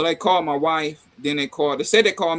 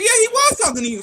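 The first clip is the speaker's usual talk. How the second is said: higher, louder scolding; mock exasperated; 5 dB louder than usual. Father scolds his daughter, higher and exasperated. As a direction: point out the obvious with exasperation.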